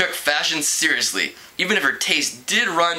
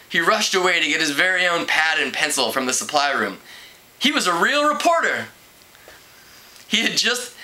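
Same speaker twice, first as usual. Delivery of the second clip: ordinary delivery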